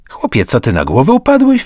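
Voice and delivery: rising to a high, loud disbelieving cry; a friend shouting in disbelief at his plan; incredulous exclamation. A narrator's reading. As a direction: shout it as if he's lost his mind. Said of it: "wykrzyknął".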